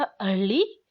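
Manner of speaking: incredulous rising "early?"; questioning disbelief. Retold or imitated. Father questioning his son's excuse, incredulous. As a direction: toss the word back in disbelief. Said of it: "एक सवालिया अंदाज में".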